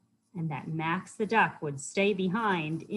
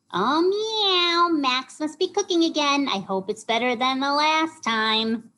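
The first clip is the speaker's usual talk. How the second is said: high, loud meowing cat voice; high-pitched storybook cat; cat character; 8 semitones higher, 6 dB louder than usual. A narrator's reading. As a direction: meow it out high like a cartoon cat.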